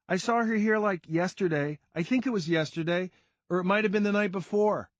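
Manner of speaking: put-on high girl voice, casual; a young girl apologizing; girl's voice. A narrator's reading. Girl answering narrator at pool table, high casual voice.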